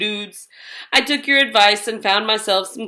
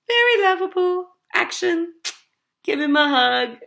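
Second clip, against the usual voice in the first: higher, quick cutesy voice; perky sweet read; 6 semitones higher than usual. A narrator's reading. Hacker showing off the edited file, cutesy. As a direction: sell it sweetly.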